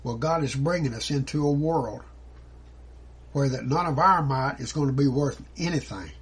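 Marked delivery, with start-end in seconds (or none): none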